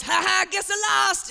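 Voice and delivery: high, drawn-out mock gloating; slow, high-pitched playful "ha ha"; gleeful kid. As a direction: imitate a kid crowing over a trick. Mimics kid gleefully conceding the bet.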